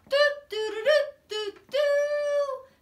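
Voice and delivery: very high, loud toy-horn doots; playful sung horn imitation; horn toots. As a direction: toot like a little trumpet. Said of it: "come blow your horn".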